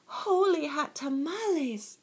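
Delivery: high, startled kitten exclamation; exclaiming. A narrator's reading. Kitten sees his reflection, exclaiming. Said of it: "exclaimed Skippy John Jones".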